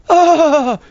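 loud, high "ahh" in short burst; high mock "ah" cry. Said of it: "Listen to my lip".

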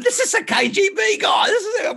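very high, loud squawk of alarm; alarmed mock shout. Telling Barbara about the deputy mayor, hammed-up alarmed shout.

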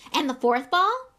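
very high, loud, breathless little fan; eager young boy. A narrator's reading. Young fan Colin rushes to show Harry his photo, very high. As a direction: bounce with excitement at your hero.

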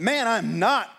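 loud, high denial; Peter. Acted. Peter denies his accuser in the courtyard, forceful denial. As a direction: deny it forcefully. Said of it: "Peter replied".

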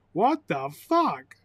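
usual speaking voice

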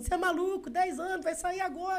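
high mocking impression of friends calling him crazy; mocking the doubters. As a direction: squeak it like the crowd thinks you're crazy. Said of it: "todo mundo".

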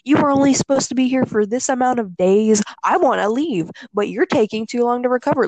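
imitating mom yelling. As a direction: scold like an impatient mother. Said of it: "She was yelling at me".

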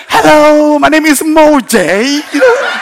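very high, loud mock-British greeting, drawn out; hammed-up proper English teacher; Moji's British accent. Preacher imitating his helper greeting the guest, exaggerated British accent. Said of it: "Moji has got a very nice British accent".

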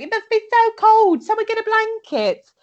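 high, loud fussing urgency; mock panic. Jenny fussing over the cold baby, high loud mock panic. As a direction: squeal for a blanket. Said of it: "So Jenny's like".

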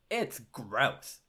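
GROSS hit emphatically, as written; exclamation point. Acted. Host reading out the teen's letter, emphatic exclamation on GROSS. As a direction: read It's gross with emphasis on GROSS. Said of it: "Exclamation point".

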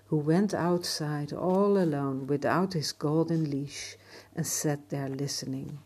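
plain reading voice

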